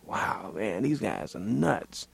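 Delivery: soft, slow, amazed reaction; hushed, drawn-out retold disbelief; stunned aside. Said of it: "was like".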